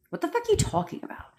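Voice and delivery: high, soft, quick incredulous question; hushed high-pitched bafflement; baffled disbelief. Real, not acted. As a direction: squeak it out in disbelief. Baffled by a viewer's comment.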